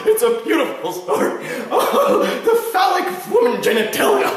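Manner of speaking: high-pitched, laughing mock rapture; hammed-up giddy raving, cracking up; manic ranting. Acting out Brian's manic ranting.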